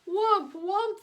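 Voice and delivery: shrill, loud, high panicked creature cry; frightened Wump cries. Acted. The frightened Wumps cry out at the machines, shrill and panicked. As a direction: shriek it in wild panic. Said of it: "they cried".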